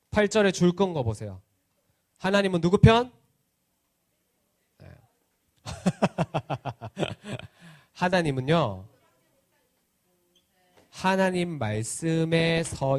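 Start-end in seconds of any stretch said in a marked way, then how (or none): none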